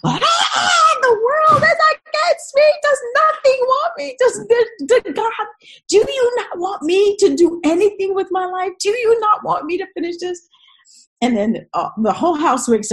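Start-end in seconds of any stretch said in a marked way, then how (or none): 0.0-10.5 high, loud wailing rant at God; acted hysterical screaming fit; screaming meltdown. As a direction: re-enact losing it completely, crying out at full pitch. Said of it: "I started screaming and lost my mind".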